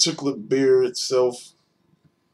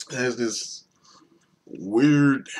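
ordinary talk, nothing marked

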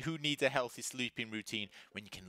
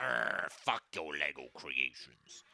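put-on growly toddler voice; 9 semitones lower, slower than usual.

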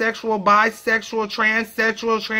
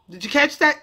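ordinary talk, nothing marked

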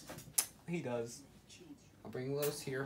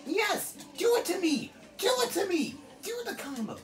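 manic taunt. Real, not acted. Taunting the CPU boss, high manic shouting. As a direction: dare the boss to hit harder.

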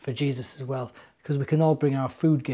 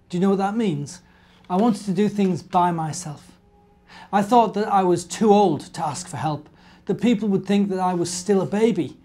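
bright, lively storybook voice, a little higher and louder; reading to children; 5 semitones higher, 7 dB louder than usual. A narrator's reading. Presenter reading an Advent story to children, lively storybook voice. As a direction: tell it like a bedtime story for little ones.

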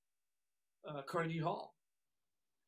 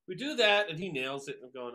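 ordinary delivery

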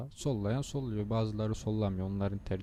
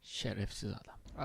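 muttered curse; 7 dB quieter than usual. Real, not acted. Driver mutters a curse at a blocking trucker, soft grumble. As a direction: swear quietly to yourself.

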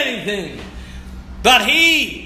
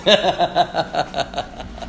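soft laugh; 4 semitones lower, 5 dB quieter than usual. Real, not acted. Laugh after the quiet voice.

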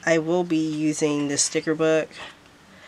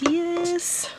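excited yes; 12 semitones higher than usual. Retold or imitated. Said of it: "So I was like".

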